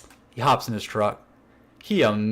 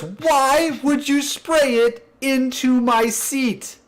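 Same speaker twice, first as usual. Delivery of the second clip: very high, slow, squeaky disbelief; exasperated coworker; 11 semitones higher, much slower than usual. Retold or imitated. Coworker asking the prankster why, high and slow. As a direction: stretch it out in a high whine.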